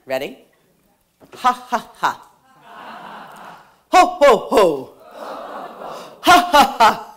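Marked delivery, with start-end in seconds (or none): 1.3-7.1 deliberate, separated laugh syllables, growing louder; laughter yoga fake laugh, building. Shown as a demonstration. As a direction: chant out a forced belly laugh for a group to follow. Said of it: "start with a fake laugh".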